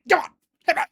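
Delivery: high, loud, put-on coaxing voice; eager urging. Rain urging Melia to hit him, hammed-up eagerness. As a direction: egg her on impatiently.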